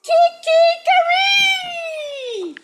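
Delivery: high, loud, slow sung rooster call; rooster crow. Acted. Rooster greeting the morning, a long sung crow. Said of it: "He sang".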